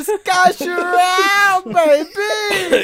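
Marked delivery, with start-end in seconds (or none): 1.6-2.8 laughing cry. Real, not acted. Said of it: "Can you do that louder?".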